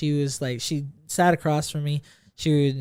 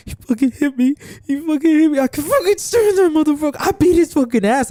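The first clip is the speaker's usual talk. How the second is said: much higher imitated voice of a furious girl; upset girl; 11 semitones higher, faster than usual. Mimicking a classmate ranting about her boyfriend, high and heated.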